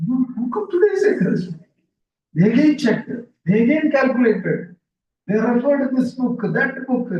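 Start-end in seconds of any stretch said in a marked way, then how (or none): 0.1-1.6 high, fast, shocked cry of disbelief; horrified disbelief. Retold or imitated. Astrologers find their reckoning wrong, shocked disbelief. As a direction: gasp it out, stunned the calendar was wrong. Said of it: "To their horror".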